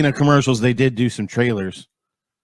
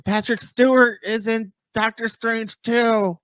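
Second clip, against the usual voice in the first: mocking trailer breakdowns; 9 semitones higher than usual. Imitating YouTubers who drive him nuts, mock revelation. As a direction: play an obsessive fan announcing a find, pitched way up. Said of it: "people are driving me nuts".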